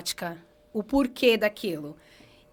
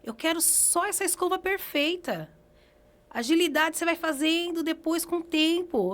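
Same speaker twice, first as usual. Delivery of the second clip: instructing teacher; 7 semitones higher than usual. Retold or imitated. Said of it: "Agora vem, vem pincelando ele".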